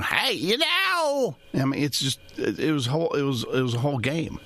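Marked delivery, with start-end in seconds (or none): 0.0-1.4 high, slow drawn-out Festus twang; much higher put-on cowboy sidekick voice. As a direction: stretch it out like the TV deputy. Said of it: "Instead of going".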